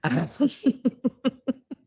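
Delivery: high, embarrassed giggle; flustered run of high laughter; real blushing giggle; bashful laugh. Real, not acted. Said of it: "I've got a red face".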